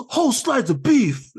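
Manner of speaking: high, hammed-up boy narrator imitation; Squints. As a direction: push the legend's detail high for effect. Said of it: "his narration".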